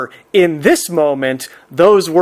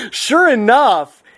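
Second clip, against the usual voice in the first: ordinary delivery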